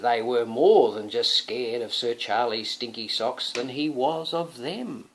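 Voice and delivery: plain reading voice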